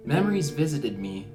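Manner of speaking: ordinary talk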